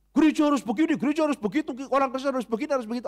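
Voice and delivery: high, insistent demanding list; mock demands. Churchgoers demanding the church be perfect, insistent and high.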